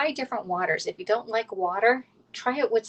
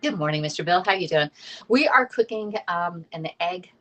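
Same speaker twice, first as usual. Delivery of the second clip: ordinary delivery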